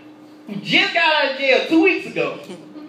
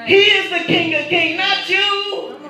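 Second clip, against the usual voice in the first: fervent preaching; 6 semitones higher, 3 dB louder than usual. Retold or imitated. Preaching forgiveness to the inmate.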